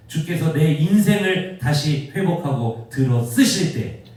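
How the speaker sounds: loud preaching, building in force; impassioned preaching. Real, not acted. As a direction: drive it home to the congregation.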